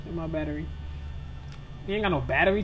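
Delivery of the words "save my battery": ordinary talk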